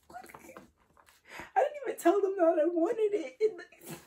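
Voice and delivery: high, slow, tearful whine; tearful amazement. Real, not acted. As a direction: wail it slowly, touched to tears. Moved by long-wanted gift.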